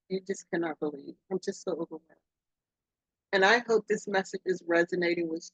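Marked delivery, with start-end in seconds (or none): 0.1-2.1 much softer, voice dropping away with emotion; overwhelmed hush. Real, not acted. Reader moved by the card, hushed and overwhelmed. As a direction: let the joy leave you almost speechless. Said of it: "I'm so overwhelmed with joy right now".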